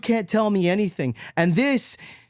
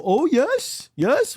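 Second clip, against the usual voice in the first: mock approving coo; 6 semitones higher, much slower than usual. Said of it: "people will go".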